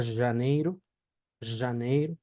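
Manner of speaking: janeiro as "zha-NEY-ru"; Portuguese pronunciation demo. Shown as a demonstration. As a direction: buzz the j of janeiro, end it in "ru". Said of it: "Entonces, por ejemplo".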